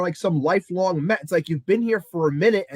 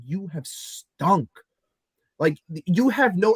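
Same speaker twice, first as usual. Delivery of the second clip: normal talking voice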